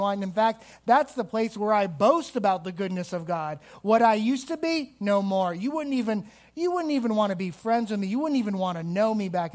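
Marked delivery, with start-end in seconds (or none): 3.8-5.5 boasting exclamation. Real, not acted. Preacher boasting about his past.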